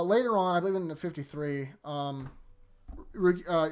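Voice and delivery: ordinary talk, nothing marked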